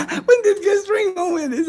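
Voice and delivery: high-pitched, laughing through the words. Real, not acted. Host repeating a viewer's Gus Fring question, laughing. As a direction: repeat it cracking up, voice shooting up.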